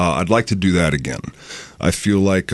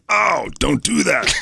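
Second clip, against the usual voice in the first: slow, higher, flat doll recording; lightly mimicked doll crying out from the next room; talking doll voice; 7 semitones higher than usual. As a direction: play a cheap toy voice box. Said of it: "You can just hear".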